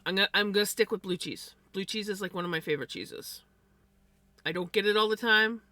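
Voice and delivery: usual speaking voice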